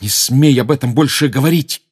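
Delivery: loud, furious snap; angry bark. Acted. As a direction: bark the order at him. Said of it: "рявкнул Гарри".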